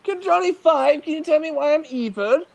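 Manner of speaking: very high, plaintive villain voice; evil banker. Acted. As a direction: plead as a hammed-up cartoon baddie. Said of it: "he'd be talking like this".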